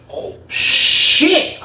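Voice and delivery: high, drawn-out whispered amazement; stunned reaction. Retold or imitated. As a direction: relive being stunned, mostly breath.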